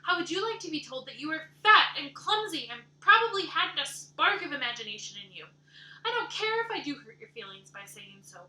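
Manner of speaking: loud, high, indignant shouting; furious outburst. Acted. Anne raging at Missus Rachel for insulting her looks. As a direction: hurl the insults back at her.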